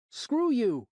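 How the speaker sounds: put-on high, loud kid shout; a mouthy boy yelling at an adult; yelling brat.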